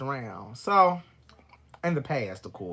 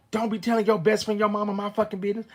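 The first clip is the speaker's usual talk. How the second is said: higher, louder imitation of an upset ex; angry warning; 6 semitones higher, 4 dB louder than usual. Drunk ex accusing partner of sharing his business, angry warning. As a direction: scold in a raised, insistent voice. Retold quarrel, ex warning her.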